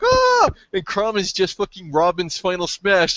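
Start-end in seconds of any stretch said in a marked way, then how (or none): 0.0-0.5 high-pitched fanboy scream, re-enacted; excited scream. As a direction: let out a high, loud mock scream. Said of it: "and I was like".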